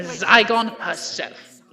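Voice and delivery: savouring the exposure; slow dramatic reveal. Acted. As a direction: let Zygon land slowly. PM exposing rival as alien.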